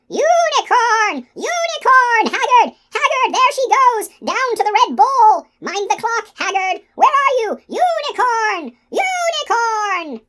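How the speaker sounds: very high, loud, drawn-out alarm; piercing creature screech, long calls; shrieking skull. A narrator's reading. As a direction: shriek to raise the whole castle. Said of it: "the skull shrieked".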